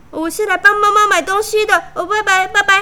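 high, fast flustered excuse; nervous excuse. A narrator's reading. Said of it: "她的朋友们看了就吓到了".

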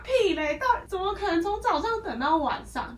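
high, loud scoffing retort. Retold or imitated. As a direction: scoff at the ridiculous claim. Mocking the customer's exaggeration.

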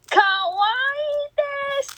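high, sing-song anime kawaii voice; gushing over a cute dog, high kawaii voice; cute Japanese gush. Pretend. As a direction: squeal it like a cutesy anime girl.

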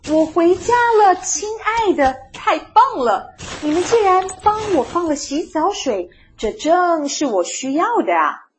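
high, loud, delighted call; shouting out. A narrator's reading. Mole thrilled to find a bath, shouting out. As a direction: cry out happily to the family. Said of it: "大声地叫着".

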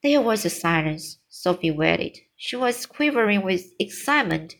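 usual speaking voice

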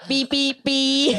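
slow, drawn-out mock bleep, laughing; bleep censor sound. Host dodges whether she's single, mock bleeping with a laugh. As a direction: bleep out your own answer. Dodging question about being single.

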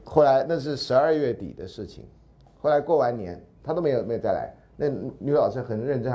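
ordinary talk

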